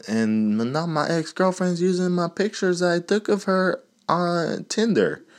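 whiny friend. Acted. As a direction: whine it like a wounded ex. Acted whining friend.

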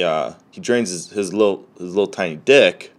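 ordinary talk, nothing marked

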